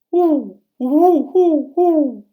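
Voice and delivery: high loud hooting owl call, drawn out. Acted. Pa calling out to the owl in the woods, loud high hoots. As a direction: hoot like a great horned owl. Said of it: "And then Pa called".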